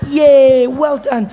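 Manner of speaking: high, loud congratulating cheer; class cheering. Acted. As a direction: cheer the reader like a delighted class. Class applauds Shirley's reading.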